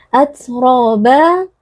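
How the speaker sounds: "Atroba" chanted slowly with the final a held; lengthened ending. Shown as a demonstration. Teacher shows the verse ending, "Atroba" with long last vowel. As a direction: draw out "roba" in "Atroba". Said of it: "membacanya panjang satu alif".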